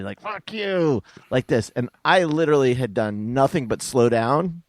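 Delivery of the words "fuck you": high-pitched, acted-out insult without shouting; mock aggressive. Driver retelling the guy who flipped him off, mock aggressive. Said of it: "like, really aggressively".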